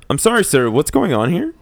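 stern police officer; cop voice. Acted. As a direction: question him like a patrol cop. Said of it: "I had to use my cop voice there".

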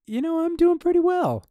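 squeaky-high, loud claim of being fine; voice lifted high. Real, not acted. Answering partner's check-in, voice leaping very high. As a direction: let the voice shoot up by octaves. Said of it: "Your voice only lifted about two octaves".

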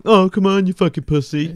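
a hammed-up sore loser's taunt; mocking taunt.

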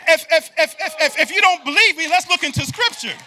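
loud, high, ringing preacher call; hammering the repeated "if"; preaching at full force. Acted. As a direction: build the congregation up with fire.

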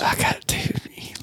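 breathy whisper, no voice; just whisper it to him. Real, not acted. Hushed reply, unvoiced.

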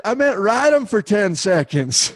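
high, slow line cracking into laughter; laughing explanation. Retold or imitated. Friend explains the misunderstanding, laughing.

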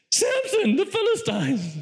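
very high mock cry of alarm; mock scream. Delilah screams a warning to Samson, mock scream. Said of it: "And she screams".

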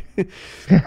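a sudden loud laugh, high and bright; loud burst of laughter. Real, not acted. Reading a crazy tweet to his co-host, loud laugh.

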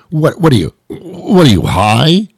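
high, stammering mock disbelief; exaggerated, high-pitched incredulity; incredulous. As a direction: sputter at an absurd suggestion. Scornful reply to curling invitation.

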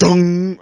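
imitated tap sound.

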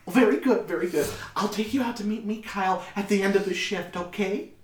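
high, approving mother voice; high-pitched, obliging motherly chatter; bustling mother. Acted. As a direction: make plans in a high voice.

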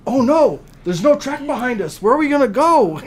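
high, loud pretend terror; mock panic. Dad scaring his daughter on the coaster, mock panic. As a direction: ham up the alarm to scare a kid.

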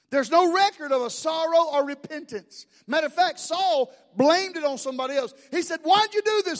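a preacher pressing his point hard; full-force preaching. Acted.

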